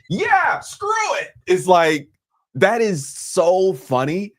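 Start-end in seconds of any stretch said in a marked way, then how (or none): 0.1-1.3 high mock heckler voice; off-mic heckle. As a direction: toss it off like a heckler. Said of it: "Whoever is saying off mic".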